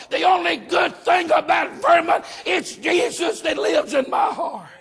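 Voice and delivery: fired-up Southern pulpit shout; full-force preaching. Real, not acted. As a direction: preach it out to the whole church.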